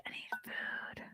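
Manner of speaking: whispered to herself. Real, not acted. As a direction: mutter it under your breath. Muttering to self, unvoiced.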